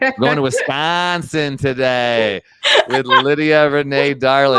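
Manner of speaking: put-on playful Wisconsin accent introducing the guest. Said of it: "let me see if I get this accent".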